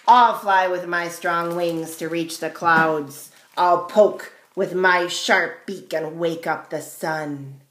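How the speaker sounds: loud storybook crow; a hint of a self-assured crow; lightly voiced boastful crow. A narrator's reading. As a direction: declare it loudly as the crow.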